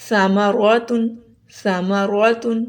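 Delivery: سامارواتن slowly, opening on a lisped th as in "think"; tha example word. Shown as a demonstration. Teacher gives an example to practise, slow lisped سامارواتن. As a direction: stretch سامارواتن with a heavy rounded "raw". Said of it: "تو اس کی مثال لیجئے".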